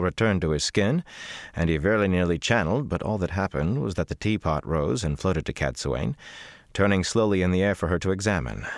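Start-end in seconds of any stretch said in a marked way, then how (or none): none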